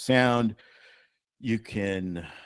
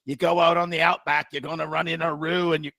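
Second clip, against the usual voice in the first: higher-pitched broad Aussie; put-on outback Australian; Australian accent; 5 semitones higher, 3 dB louder than usual. Aussie tire man advising on tire pressure, broad Australian accent. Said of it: "That accent".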